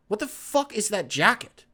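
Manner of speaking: ordinary delivery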